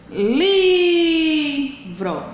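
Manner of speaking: "Livro" with a long, high LI; LIIII-vro, as a stress demo; stressed syllable drawn out. Shown as a demonstration. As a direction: stretch the first syllable of "Livro", then drop to VRO. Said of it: "pronunciado com força".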